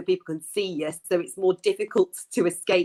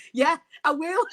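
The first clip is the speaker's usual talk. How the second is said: higher-pitched, eager retort, laughing as you tell it; animated granddad; 9 semitones higher, 4 dB louder than usual. Retold or imitated. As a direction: play the game granddad taking up the challenge. Acting granddad's defiant reply to ref.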